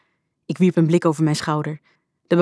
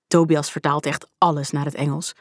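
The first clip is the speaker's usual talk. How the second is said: ordinary delivery, nothing marked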